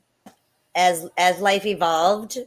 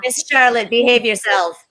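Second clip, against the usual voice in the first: playful scolding; 6 semitones higher, 7 dB louder than usual. Acted. Scolding a child in fun.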